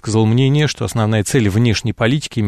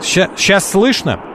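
high, slow, raised check of the line; speaking louder; 7 semitones higher, slower than usual. Real, not acted. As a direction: call out slowly to be heard. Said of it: "говорите громче".

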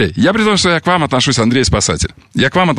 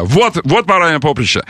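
ordinary talk

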